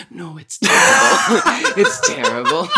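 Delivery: loud, high, cackling mock agreement; laughing verdict. Retelling a guest's verdict on their Scottish accent, laughing loudly. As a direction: crack up while delivering the retold insult. Acting out the friend's blunt verdict.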